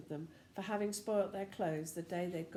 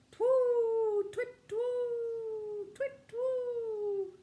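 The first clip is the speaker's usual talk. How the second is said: high, loud hooting screech; owl screech; 6 dB louder, much slower than usual. Acted. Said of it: "screeched loudly".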